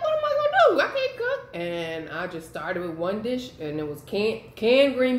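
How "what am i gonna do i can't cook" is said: much higher, mock panicked new mom. Retelling new motherhood, mock panicked. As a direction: fret like a mom who can't cook. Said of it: "I'm like".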